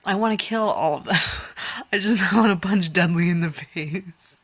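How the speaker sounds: loud, laughing rant; joking fury with a giggle; giggling rant about the Dursleys; laughing frustration. Real, not acted.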